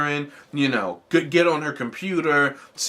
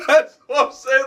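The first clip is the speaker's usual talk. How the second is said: said through a laugh, higher and louder; amused, laughing agreement; 10 semitones higher, 6 dB louder than usual. Real, not acted.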